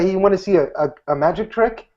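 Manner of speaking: tentative pitch for a trick; wrong pitch. Shown as a demonstration. Magician demos the wrong opener, a timid sales pitch. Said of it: "if I walk up and say".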